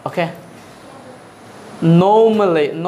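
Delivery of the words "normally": normally slow and loud, R silent, "NOM-lee"; British pronunciation. Shown as a demonstration. Teacher introducing the word, slow loud R-less "nom-lee". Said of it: "কিন্তু ব্রিটিশরা কি বলে?".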